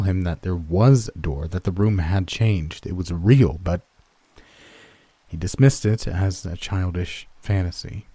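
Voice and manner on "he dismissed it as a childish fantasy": ordinary delivery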